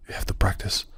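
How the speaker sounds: "practice" whispered in a voice unlike his own; whispered advice. Retold or imitated. Recalling what everyone told him about practice, whispered. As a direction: quote the old advice under your breath. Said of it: "I think they have told me".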